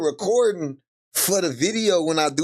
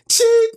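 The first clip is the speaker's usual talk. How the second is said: short, piercing high chirp, loud; imitated chirp; 7 dB louder than usual. Streamer mimicking the battery chirp, loud high squeak. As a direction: squeak out single sharp beeps. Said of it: "Motherfucker said".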